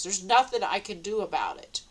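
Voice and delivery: usual speaking voice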